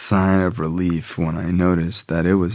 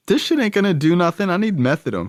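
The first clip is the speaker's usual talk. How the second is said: scoffing complaint; 11 semitones higher, faster than usual. Retold or imitated. Scoffing at Suboxone.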